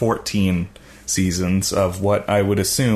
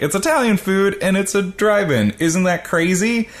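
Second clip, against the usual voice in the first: higher-pitched imitation of a TV introduction; excited intro; 10 semitones higher than usual. Podcaster mimicking the show's first introduction, excited. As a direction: hype it up, high, like a show host. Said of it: "the first introduction we get is".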